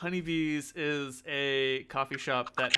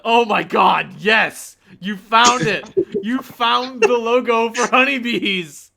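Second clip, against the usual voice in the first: high, loud squealing excitement; over-the-top thrilled yelling; very excited barista; 9 semitones higher, 16 dB louder than usual. Acted. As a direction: shout it like an overexcited barista greeting a find. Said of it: "are very excited".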